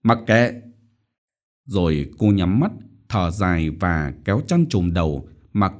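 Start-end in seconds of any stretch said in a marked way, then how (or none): 0.0-0.6 short exasperated dismissal; irritated. A narrator's reading. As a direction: wave him off in a huff. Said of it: "Cô cáu tiết". (cáu kỉnh gạt đi)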